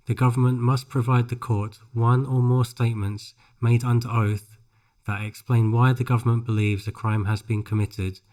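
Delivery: usual speaking voice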